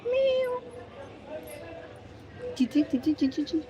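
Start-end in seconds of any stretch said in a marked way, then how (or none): 0.0-0.5 high, soft imitated cat meow; playful high meow to the pet cat; mock meow. Meowing back at her cat.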